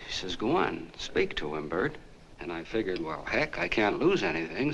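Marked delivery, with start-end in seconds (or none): none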